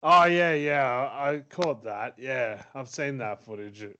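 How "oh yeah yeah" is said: excited. Real, not acted. Sudden recognition.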